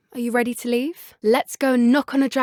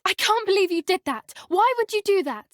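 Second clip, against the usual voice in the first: higher, outraged disbelief; stunned, higher protest; shocked disbelief; 8 semitones higher than usual. Acted. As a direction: react as if betrayed. Reacting to shocking act.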